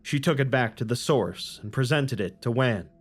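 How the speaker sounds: measured, solemn storytelling read; story narration. A narrator's reading. Narrator reading scripture to the audience, solemn storytelling. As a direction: tell it like old scripture to listeners.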